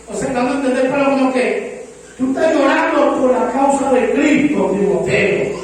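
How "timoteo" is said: ordinary delivery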